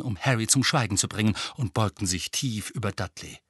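usual speaking voice

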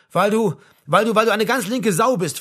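loud, high, stumbling accusation; heated, agitated start-and-restart; angry outburst. A narrator's reading. Acted quarrel after shouting.

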